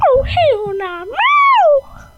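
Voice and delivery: high sassy cat shout ending in a piercing meow; be the cat, refusing, then meow it out; loud hammed-up cat voice with a meow. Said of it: "That's what she said in her cat voice".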